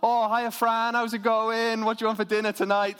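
high, loud, chummy mum voice switching off the posh act; family voice. Retold or imitated. Mum recognising her daughter on the phone, high and loud family voice. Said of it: "the voice completely switches to family voice".

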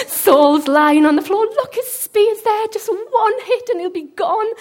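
loud, high, urgent egging on; worked-up, eager urging; excited. Acted. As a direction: act out a soldier thrilled at his chance. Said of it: "So excited".

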